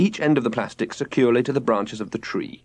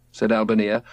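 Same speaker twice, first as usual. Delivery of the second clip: ordinary delivery, nothing marked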